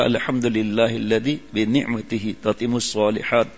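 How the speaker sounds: recited Arabic dua. Shown as a demonstration.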